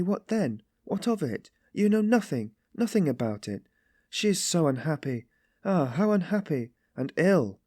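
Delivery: high, impassioned defence; deeply stirred. A narrator's reading. Sonia defends Katerina to Raskolnikov, stirred and anguished. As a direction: champion her with compassion, voice lifted. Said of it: "stirred to the very depths".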